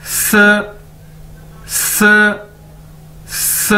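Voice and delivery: careful Chinese sì, clipped and clear; teacher modelling the Chinese word स; model pronunciation. Shown as a demonstration. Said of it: "इसको इशारे में इस तरह से जाहिर किया जाएगा".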